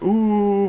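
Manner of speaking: high, loud mock ghostly ooh; spooky ooh. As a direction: moan a playful ghost sound. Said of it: "a spooky voice".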